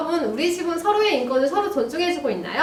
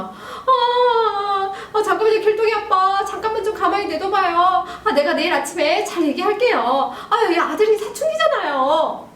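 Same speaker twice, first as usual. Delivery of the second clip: high, pleading mom voice; mom intervening; 7 semitones higher than usual. Acted. Mother holds dad back from son, high acted pleading. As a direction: talk an angry husband down, higher and urgent. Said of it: "그때 엄마".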